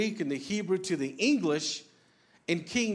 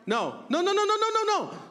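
each no climbs higher and louder until the last is high and loud; repeated rising no; 13 semitones higher, 4 dB louder than usual. Shown as a demonstration. Parent scolding a child, no after no climbing high and loud. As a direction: scold a child with a string of no's that climbs steeply. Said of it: "that ninth no on a high pitch is really emphasizing".

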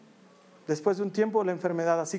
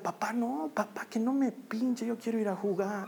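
a hint of a small girl begging her dad, higher and softer; pleading little girl; 3 semitones higher, 4 dB quieter than usual. Dad voicing his sick daughter as she begs him, softly pleading. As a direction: plead like a frightened child.

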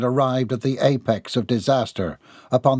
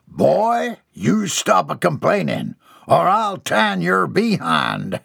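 higher, slower scolding mother; scolding country mother; 6 semitones higher, slower than usual. Acted. The mother threatens her grown son, lightly voiced scolding. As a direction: threaten him like a stern ma.

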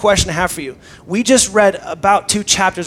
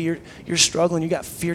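ordinary talk

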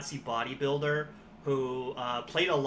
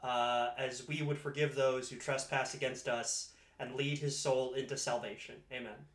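prayer; 4 dB quieter than usual. Pretend. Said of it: "give a quick prayer".

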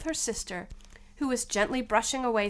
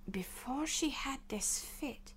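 whisper; 3 semitones higher, 11 dB quieter, slower than usual. Acted. King reads.

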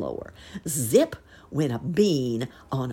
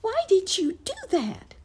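squeaky mouse teacher; 3 dB louder than usual. A narrator's reading.

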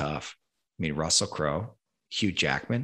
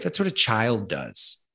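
ordinary delivery

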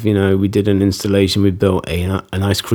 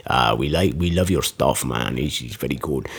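put-on lower, softer, self-admittedly poor Italian accent; bad Italian accent; 7 semitones lower, 6 dB quieter than usual. Italian bikers praising the show, in a bad Italian accent. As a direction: put on a clumsy Italian accent, low and soft. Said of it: "not very good at the Italian accent".

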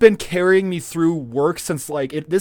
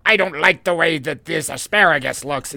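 lightly voiced fussy old lady; a hint of a complaining old customer; 4 dB louder than usual. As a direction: sniff at the vegetables like a picky grandma. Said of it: "my go-to old lady voice".

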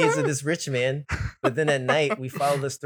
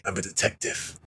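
Batman voice; 4 semitones lower, 4 dB quieter than usual. Retold or imitated.

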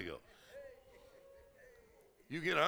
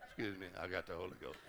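lower, softer, fast embarrassed excuse; sheepish apology; 8 semitones lower, 9 dB quieter, faster than usual. Retold or imitated. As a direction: rush it out, half-laughing.

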